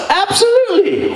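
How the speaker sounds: high bright absolutely; high eager absolutely; eager yes. Retold or imitated. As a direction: chirp it up high. Dylan high agreement.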